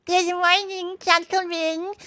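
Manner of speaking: high, put-on polite voice; respectful washerwoman. A narrator's reading. Mole disguised before the sentries, respectful. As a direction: greet them in high mock deference. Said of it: "very respectfully".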